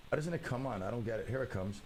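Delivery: usual speaking voice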